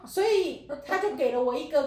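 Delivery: ordinary talk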